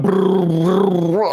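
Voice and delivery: lips burbling like underwater bubbles; mock bubble noise. Player makes bubble sound escaping breath. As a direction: blow out a bubbly brrr. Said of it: "I can't make the bubble sound".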